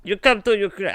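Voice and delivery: lightly hammed-up Ukrainian accent; mock Ukrainian accent. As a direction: do a bad Ukrainian accent on purpose. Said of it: "I can't do a good Ukrainian accent".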